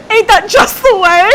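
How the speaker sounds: high, loud, laughing outburst; cracking up. Real, not acted. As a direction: squeal the catchphrase through laughter. Laughing fit over a quoted catchphrase.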